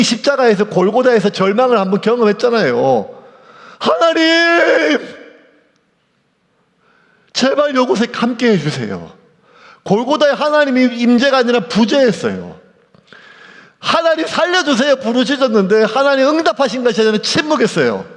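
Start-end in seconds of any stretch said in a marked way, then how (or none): 3.8-9.2 high, drawn-out cry to God; desperate plea. Acted. Jesus crying out at Golgotha. 13.8-15.0 high, begging call for rescue; pleading cry. Acted. Jesus crying out at Golgotha.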